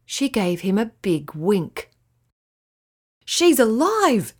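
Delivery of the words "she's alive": slow, amazed exclamation; astonished cry. A narrator's reading. Fred sees the sand turtle wink, slow amazed cry. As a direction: let the wonder spill out slowly. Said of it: "cried Fred".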